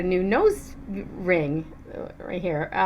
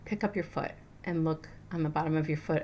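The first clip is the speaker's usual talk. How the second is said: inner voice; 3 semitones lower, 8 dB quieter, faster than usual. Retold or imitated. And something said.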